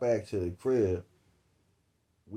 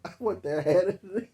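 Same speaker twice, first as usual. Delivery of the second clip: higher, sweet mock-girlish demand; a hint of a spoiled girlfriend asking; playful imitation of her wanting the hat; lightly voiced girlfriend; 10 semitones higher than usual. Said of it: "She came out there and just said".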